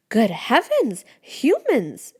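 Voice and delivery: a little mushroom spotting humans, shocked; shrieking. A narrator's reading. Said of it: "it shrieks".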